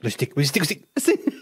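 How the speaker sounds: a dog who can't contain himself at sticks; excited about sticks. Acted. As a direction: puppet dog losing it over sticks.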